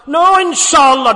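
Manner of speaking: slow, loud, drawn-out no inshallah; mock listener yelling his excuse. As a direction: boom out the excuse. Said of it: "Don't say that loud".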